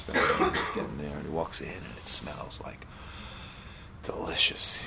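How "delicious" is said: whispered delight. Retold or imitated. Smelling the holiday meal.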